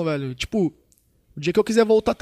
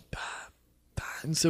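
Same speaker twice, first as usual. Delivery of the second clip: whisper; 4 semitones lower, 11 dB quieter than usual. Retold or imitated. Mocking nagging ex-girlfriends.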